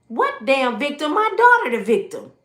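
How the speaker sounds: indignant, rising high and loud at the accusation; outraged protest. Retold or imitated. Woman protesting to the cop that her daughter is the victim, outraged.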